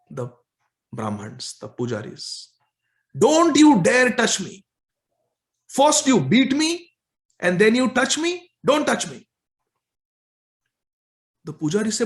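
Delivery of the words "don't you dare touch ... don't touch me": high, loud scolding; chastising. Acted. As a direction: rebuke them hard, one by one.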